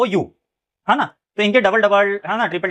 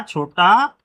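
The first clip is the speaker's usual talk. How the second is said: slow emphasis; much slower than usual. Shown as a demonstration. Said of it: "और ये क्या जुड़ा?".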